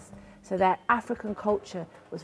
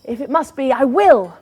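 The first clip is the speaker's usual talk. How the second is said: higher and louder, gruff consent; grudging Tiger; 5 semitones higher, 14 dB louder than usual. Acted. Tiger reluctantly agreeing to carry Anansi, loud grumble. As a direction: give in with a growl.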